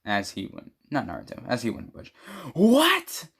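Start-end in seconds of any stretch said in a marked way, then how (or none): 2.5-3.3 very high, loud shriek of disbelief; shocked outburst. Acted. Sakura reacting to Kakashi's exam news, shocked outburst. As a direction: burst out in high-pitched shock.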